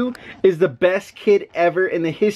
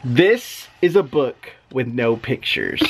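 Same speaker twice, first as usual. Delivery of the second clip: put-on reading to children; 5 semitones lower than usual. Dad reads book aloud.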